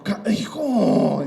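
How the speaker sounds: jolted awake by a shape; startled father. Retold or imitated. (padre sobresaltado)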